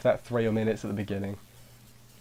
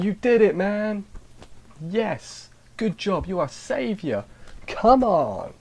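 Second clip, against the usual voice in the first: high, loud hammed-up cheer from the guards; mock cheering guards; 10 semitones higher, 4 dB louder, much slower than usual. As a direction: cheer him on like overjoyed guards, much higher. Guards cheering hero after credits.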